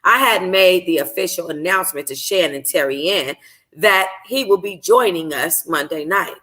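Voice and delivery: ordinary delivery, nothing marked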